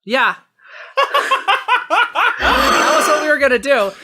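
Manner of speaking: normal talking voice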